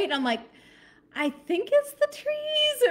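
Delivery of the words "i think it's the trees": high, drawn-out doubtful squeak; imitated wavering guess; uncertain reassurance. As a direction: stretch it out high, not convinced. Retelling unsure reassurance about noise.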